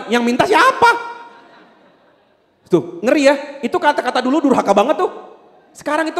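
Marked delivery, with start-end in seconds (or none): 0.1-0.9 high, loud mock back-talk; defiant retort. Child answering back to mama, loud imitated defiance. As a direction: throw it back like a cheeky child.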